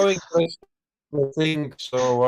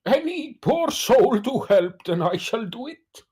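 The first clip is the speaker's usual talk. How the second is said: a lightly voiced noble knight; heroic knight voice; 6 semitones higher than usual. In-character heroic vow.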